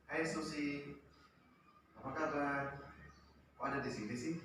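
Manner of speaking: soft, easygoing hello to the student; teacher greets a nervous student calmly, soft and patient; calm, patient greeting. Shown as a demonstration. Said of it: "ngomongnya dengan sabar, dengan santai".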